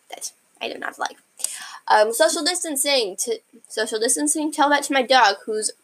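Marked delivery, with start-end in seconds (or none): 0.1-1.2 whispered aside. Real, not acted. Aside about a skipped tweet.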